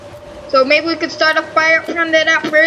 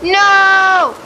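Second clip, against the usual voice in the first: furious yelling; 4 semitones higher, slower than usual. Real, not acted. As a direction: yell at the storm, fed up. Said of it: "Damn, I got pissed off today".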